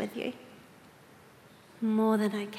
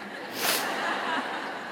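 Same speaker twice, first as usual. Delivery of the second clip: a quiet airy giggle; soft breathy laugh; 5 semitones higher, 6 dB quieter than usual. Real, not acted. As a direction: let a soft laugh slip out. Laughs at own joke.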